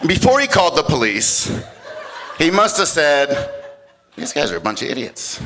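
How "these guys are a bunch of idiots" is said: low, quiet, dismissive aside; deadpan disdain. Retold or imitated. Neighbor judging brawlers from his window, deadpan disdain. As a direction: say it softly, thoroughly unimpressed.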